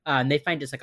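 usual speaking voice

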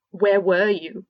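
loud, hard demand. A narrator's reading. Fred confronting his guilty twin, loud demanding. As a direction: bark the question at him. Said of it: "Fred demanded".

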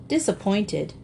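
usual speaking voice

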